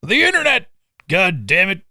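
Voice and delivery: put-on old man shaking fist at the sky; cranky geezer yelling at clouds; grumpy old man. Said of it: "I sound like that Simpsons cartoon".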